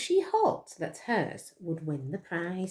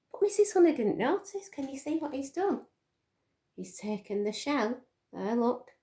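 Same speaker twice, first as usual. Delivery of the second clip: ordinary delivery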